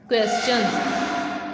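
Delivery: Questions stretched out very slowly; slow model word. Shown as a demonstration. Teacher slowly modelling questions for pupils. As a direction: drag out questions for the class.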